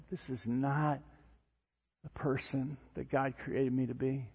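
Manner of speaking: soft, hushed self-reproach; quiet regret. Acted. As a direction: confess it softly, as if to the mirror. Voicing regret at the mirror.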